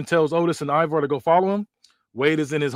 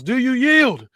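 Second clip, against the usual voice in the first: high, loud taunt mid-hold; a wrestler demanding surrender, high and loud; shouted demand; 9 semitones higher, 5 dB louder, slower than usual. Acted. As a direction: yell it while cranking the lock. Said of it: "and yells".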